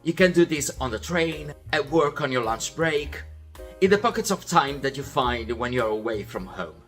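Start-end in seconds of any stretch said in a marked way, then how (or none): none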